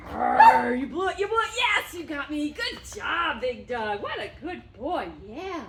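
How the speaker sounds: high, loud mock-growl into gushing praise; play-fight with the dog, then cheer; loud high happy dog talk; playful excitement. Shown as a demonstration. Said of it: "make him aware that this is fun".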